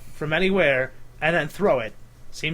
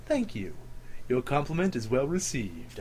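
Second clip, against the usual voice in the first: smooth deep customer; 4 semitones lower, 9 dB quieter than usual. Retold or imitated. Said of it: "he even talks smooth".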